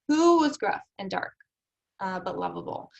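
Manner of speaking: puzzled reader asking who is meant; questioning who. Shown as a demonstration. Teacher voicing a confused reader, stress on Who.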